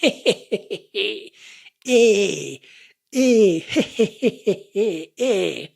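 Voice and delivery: gentle eh-heh chuckle. Shown as a demonstration. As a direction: chuckle lightly on eh. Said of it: "the sound is a laughter not so loud".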